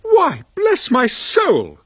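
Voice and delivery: high, slow astonished exclamation; Fred startled at the door, high and drawn out; surprised cry. Acted. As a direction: cry out in amazement. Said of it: "cried Fred".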